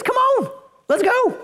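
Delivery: high and eager, come on let's go; eager invitation. Retold or imitated. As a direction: call him out of the boat with excitement. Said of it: "Jesus says".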